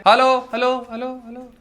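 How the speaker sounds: echoing hello, fading away; cave echo. Shown as a demonstration. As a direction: call out like into a cave. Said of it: "जैसे गूंजती है".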